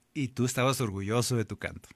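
normal talking voice

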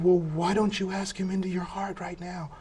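retold friend, tenderly suggesting; gentle invitation.

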